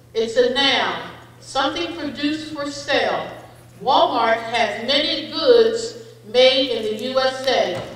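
ordinary talk, nothing marked

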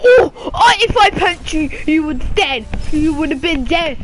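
high, loud cry through laughter; laughing outburst. Real, not acted. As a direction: blurt it out while cracking up.

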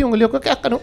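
ordinary delivery

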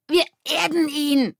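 high, loud, slow croak; strangled croak. A narrator's reading. Ole held by the collar, croaking. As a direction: croak as if being choked. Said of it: "krächzte Ole".

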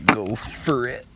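normal talking voice

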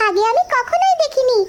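put-on slow, high, amazed childlike voice; wondering little girl. Little girl marvels at the big fish to her mother, high and slow.

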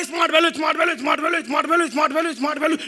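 loud street hawker's sing-song cry; imitating a hawker shouting his wares; hawker calling out. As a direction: call out the product to passing crowds. Hawker calling at Sealdah station.

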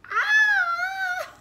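high loud startled scream; scared scream. Real, not acted. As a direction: shriek before the bite. First bite of spicy food.

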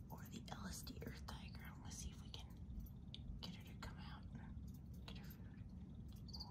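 soft whisper so she stays calm. Real, not acted. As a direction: hush it, don't spook her. Not scaring shy spider.

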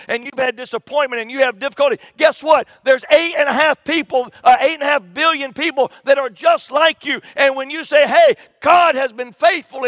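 loud, high-pitched sermon delivery; preaching at full force. Real, not acted. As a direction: drive each phrase home to the congregation, loud and high.

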